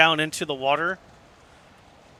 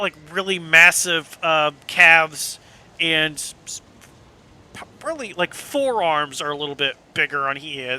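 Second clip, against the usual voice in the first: ordinary delivery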